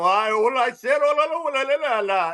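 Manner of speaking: high indignant babble; mock attitude. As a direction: caricature an agent snapping back in gibberish. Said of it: "you get an attitude with me".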